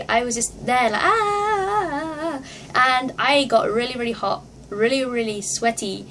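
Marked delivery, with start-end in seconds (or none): 1.0-2.4 high, drawn-out excited "ah"; re-enacted concert squeal; crazed fan scream. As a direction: wail like a fan going crazy. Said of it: "I became crazy".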